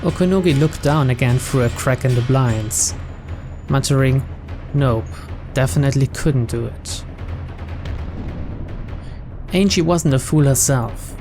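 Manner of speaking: ordinary talk, nothing marked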